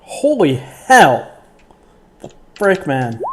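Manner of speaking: loud, high, stretched-out outburst; stunned exclamation. Real, not acted. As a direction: blurt it out in amazement at the dice. Player reacts to the dice roll.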